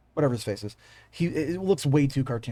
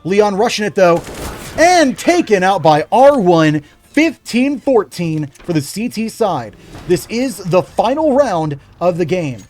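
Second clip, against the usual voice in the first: energetic esports caster voice; play-by-play commentary; 7 dB louder than usual. Acted. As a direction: call the round like a live caster. Casting live esports match.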